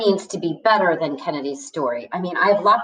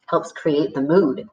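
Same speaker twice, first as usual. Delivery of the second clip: ordinary delivery, nothing marked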